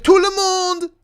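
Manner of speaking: high, loud mock French shout; French call-out. Streamer imitating how the French say everyone, high shout. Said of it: "They're like".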